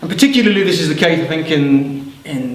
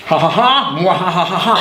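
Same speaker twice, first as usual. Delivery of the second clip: mock evil mwah-ha-ha; hammed-up villain laugh. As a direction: cackle like a pantomime villain. Narrator does the demon's laugh.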